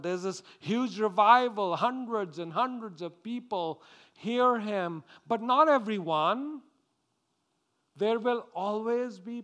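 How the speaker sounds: loud, high-pitched revival preacher; impassioned pulpit proclamation; full-force preaching. Acted. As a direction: proclaim it to the whole congregation with fervor.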